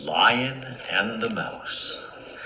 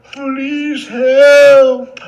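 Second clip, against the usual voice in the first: high, loud drawn-out wail; trapped lion wailing; pleading cry; 12 dB louder than usual. Acted. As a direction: call out pitifully for rescue. Trapped lion calling again.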